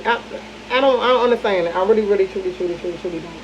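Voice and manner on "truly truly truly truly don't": fading quiet. Real, not acted. Trailing off in disbelief.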